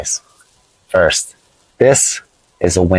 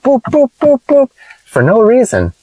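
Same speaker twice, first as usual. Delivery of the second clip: horn imitation. Teacher imitating Colombian taxi drivers honking, high mock boops. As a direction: toot like a car horn, high.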